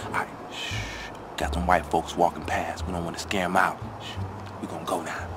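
hushed, low sneaking voice; wildlife watching whisper. Real, not acted. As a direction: whisper like stalking prey. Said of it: "we gotta be extra quiet".

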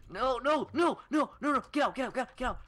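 high frantic no no; panicked pleading. Real, not acted. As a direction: beg in rising panic. Losing fight, high panic.

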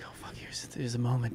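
very soft nervous whisper; acted whispered aside. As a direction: whisper it to yourself. Acted nervous aside after shouting.

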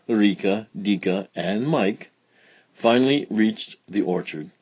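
plain reading voice

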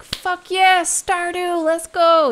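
high, loud triumphant whoop; excited cheer. Retold or imitated. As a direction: shout it like a victory cry. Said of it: "and then we'll be like".

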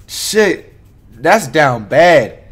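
normal talking voice

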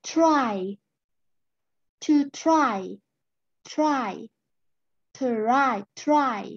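"try" with the tongue curled back for the r. Shown as a demonstration. Teacher drills the r in "try": slow, curled r. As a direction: say "try" slowly, rolling the tongue for the r. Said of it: "เราต้องหันลิ้นหน่อยนึง".

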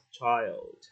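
put-on "child" drawn out slowly; slow reading. Shown as a demonstration. Teacher breaking the word down, "child" said slowly. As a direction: stretch the vowel and L of "child". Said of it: "đọc chậm thì nó sẽ là".

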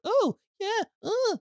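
very high, squeaky mock princess squeal; squeaky Peach voice. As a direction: pitch it way up like a cartoon princess. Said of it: "like that".

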